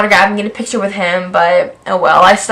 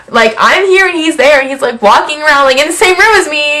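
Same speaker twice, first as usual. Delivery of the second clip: higher, louder starstruck rush; breathless fan telling friends she saw her idol; excited gushing; 4 semitones higher, 3 dB louder than usual. Real, not acted. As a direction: gush like a thrilled fan. Fan gushing about seeing Rob.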